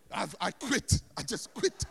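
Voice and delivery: loud mock outburst of giving up; acted fed-up declaration; exasperated quitting. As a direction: throw in the towel, loudly. Acts fed-up worship leader giving up.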